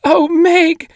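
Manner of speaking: put-on very high, loud child's cry; little brother crying out to his sister. A narrator's reading. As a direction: cry out her name in relief. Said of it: "Charles Wallace was crying out".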